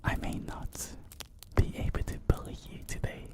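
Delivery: very soft, close whisper; playful, hushed whispered teasing. Acted. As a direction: whisper slyly into the listener's ear. Teasing listeners about surround sound.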